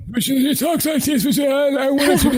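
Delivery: put-on high, thin elderly man voice; old man voice. Shown as a demonstration. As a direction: talk like a frail seventy-six-year-old. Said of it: "the old man voice".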